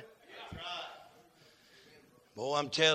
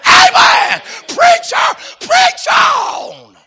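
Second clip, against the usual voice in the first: high, loud call of amen; shouted amen; 19 dB louder, much slower than usual. Real, not acted. Church member shouting amen to the preacher. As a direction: cheer the preacher on.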